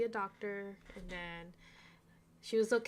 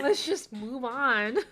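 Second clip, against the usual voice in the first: fed up; 3 semitones higher, 10 dB louder, slower than usual. Real, not acted. Fed up with repetitive book.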